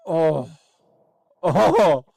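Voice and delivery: normal talking voice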